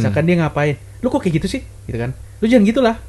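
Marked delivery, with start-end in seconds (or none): 1.0-1.6 sharp, higher nagging complaint to a partner; irritated reproach. Retold or imitated. Showing bad tone to a partner, irritated reproach. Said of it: "intonasi nada kita mengatakan sesuatu". 2.4-3.0 higher, pushy telling-off; annoyed scolding. Retold or imitated. Demonstrating a harsh order to a partner, annoyed scolding. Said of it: "Misalkan ngomong kayak gitu".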